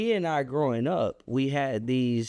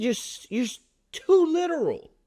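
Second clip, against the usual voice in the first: retold verdict; 13 semitones higher than usual.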